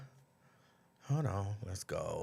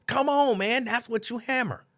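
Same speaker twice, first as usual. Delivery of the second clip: higher, emphatic come on man; exasperated; 8 semitones higher than usual. Real, not acted. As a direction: throw up your hands. Exasperated aside.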